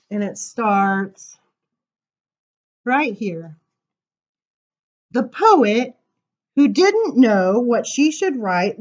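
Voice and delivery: lilting storybook read-aloud; reading to children. Acted. Reading a picture book poem to kids, sing-song. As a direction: read the looping poem with a playful swing.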